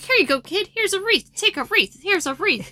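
high, bouncy mock handout voice; playful high patter, each phrase tossed out; cheery wreath hander-out. Voicing people handing out wreaths.

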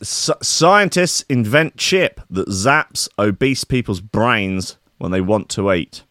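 ordinary talk

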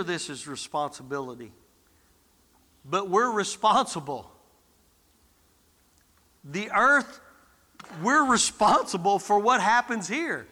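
normal talking voice

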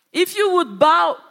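loud, high taunting tempter; Satan tempting. Acted. Satan taunts Jesus, loud and high-pitched. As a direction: push hard, baiting Jesus loudly. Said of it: "Satan comes".

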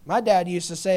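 ordinary talk, nothing marked

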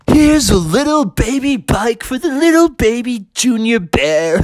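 high, mocking baby-talk tease; teasing employee. Employee handing Junior the baby bike, mocking singsong. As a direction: taunt him, ending in a laugh.

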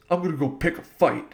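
Farley quote. Retold or imitated. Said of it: "Chris Farley's great in this movie".

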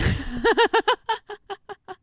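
loud, high burst of laughter. Real, not acted. Laughs at candy corn line.

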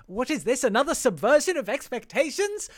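high, escalating fake shock; mock astonishment. Podcaster feigning shock at the subverted expectations, mock astonishment. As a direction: build to a loud, high mock-horrified cry.

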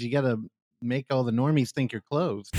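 usual speaking voice

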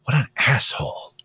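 whispered mock grumble under the breath; imitating a stranger who mutters an insult, whispering; muttered insult. Panhandler told no, mock muttering an insult under breath. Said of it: "they get to victimize themselves and go".

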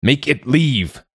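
drawn-out, high-pitched roared order. A narrator's reading. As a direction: roar it slowly, pitched well up. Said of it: "Ingolfur roared".

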